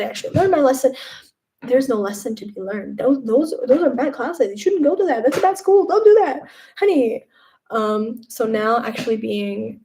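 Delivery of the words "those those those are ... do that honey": rising higher and louder into a scold, ending on honey; mock scolding parent. Streamer scolding her old habits like a mother, rising. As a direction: wag a finger like a parent warning a kid.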